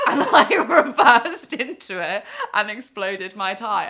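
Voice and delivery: laughing through the story. Real, not acted. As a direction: laugh while telling it.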